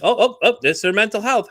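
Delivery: hammed-up dismissive excuse; mocking excuse. Mocking those who blame mental health, high and loud.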